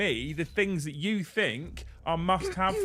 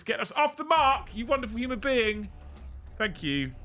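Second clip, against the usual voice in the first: excited; 7 semitones higher than usual. Real, not acted. Thanking a gifter loudly.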